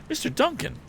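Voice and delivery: high, startled recognition; surprised exclamation. A narrator's reading. Tom recognizing the wounded hunter, a high surprised cry. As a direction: gasp out the name. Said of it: "the young inventor repeated".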